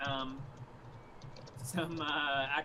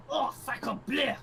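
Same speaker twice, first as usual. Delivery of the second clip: slow, exaggerated French accent cursing; French accent curse; 5 semitones higher, slower than usual. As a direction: curse like a hammy French guard.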